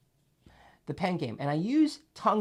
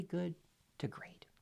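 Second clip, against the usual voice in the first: whisper; 15 dB quieter than usual. Real, not acted. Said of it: "whispered ending".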